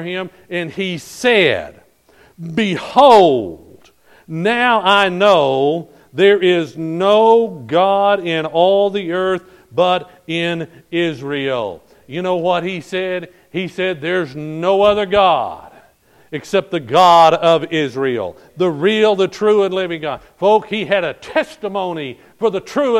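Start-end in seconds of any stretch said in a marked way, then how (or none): 2.5-11.8 loud, slow preaching declaration; solemn proclamation. Acted. Preacher reads Naaman's declaration. 14.0-20.2 loud, slow, emphatic; preaching declaration. Acted. Preacher repeats declaration forcefully.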